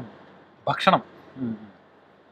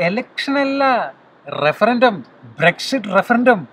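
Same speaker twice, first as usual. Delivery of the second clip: emphatic correction; 6 semitones higher, 3 dB louder, much slower than usual. Real, not acted. Correcting her election guess.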